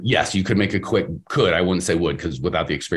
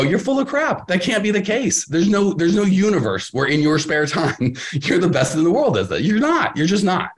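mock skeptic; 8 semitones higher than usual. Mocking a doubtful listener.

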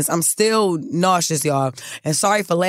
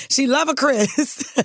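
much higher, slow teasing commenter voice; sing-song mock of gossiping fans; 9 semitones higher, slower than usual. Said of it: "Y'all be in the comments like".

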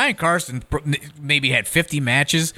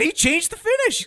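mock excuse. Excuse to angry fan.

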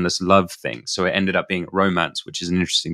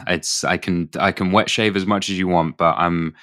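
ordinary talk

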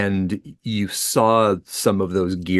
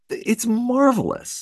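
delighted; 13 semitones higher, slower than usual. Real, not acted. Gushing about new books.

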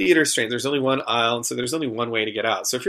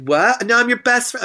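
indignant heckler; 12 semitones higher, 3 dB louder than usual. Retold or imitated. Heckler protests being kicked out.

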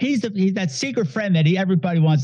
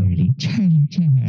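cartoon unicorn voice; 4 semitones lower than usual. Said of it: "Charlie the Unicorn".

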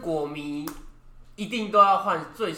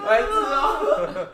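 much higher, drawn-out laughing "idiot"; playful scolding while cracking up; laughing insult; 14 semitones higher, 4 dB louder, much slower than usual. Real, not acted. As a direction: stretch it out high through laughter.

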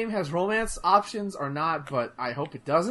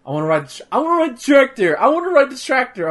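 put-on higher, louder nagging kid voice; mock whiny kid; 7 semitones higher, 7 dB louder, faster than usual. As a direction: imitate a kid begging over and over. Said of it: "he keeps going on about".

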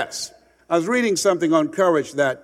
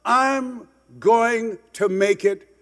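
slow, deliberate promise to himself; slow resolve; 6 semitones higher, much slower than usual. Retold or imitated. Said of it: "When you say to yourself".

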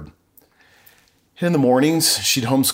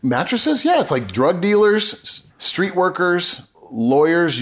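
much higher, amused disbelief; incredulous; 7 semitones higher, slower than usual. Real, not acted. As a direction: say it like it's obvious and absurd. Incredulous retort to host's question.

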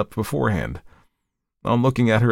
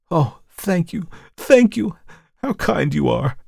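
grateful relief; 10 semitones higher, slower than usual. A narrator's reading. Grateful relief after promise.

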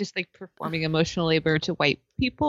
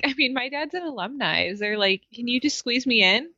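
lightly imitated entitled pleading, higher pitch; 6 semitones higher, 3 dB quieter than usual. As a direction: wheedle a favor in a mock-privileged voice.

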